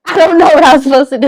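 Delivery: acted exasperated comeback; defensive protest. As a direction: protest your helplessness loudly and fast. Defends herself to questioning family.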